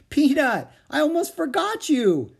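put-on loud, very high girlish exclamation; excited cry. Annie to the mouse she almost forgot, excited.

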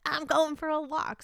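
high, eager mock declaration; eager announcement. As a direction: play up the sudden resolve, pitched high. Acting out her own resolve.

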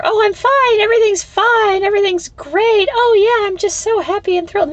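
bright fake brave face, voice way up; fake cheerful denial. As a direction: parody someone pretending all is fine. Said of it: "You don't sit there".

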